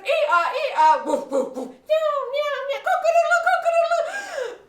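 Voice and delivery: loud bray, bark, meow and crow; animal cacophony. Acted. Animals burst through the window at the robbers, deafening mimicry. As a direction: make all four animals' noises at full blast. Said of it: "we're gonna make all the noise we can".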